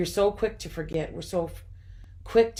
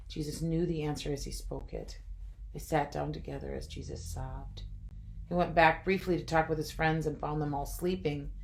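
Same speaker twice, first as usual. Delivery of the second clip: plain reading voice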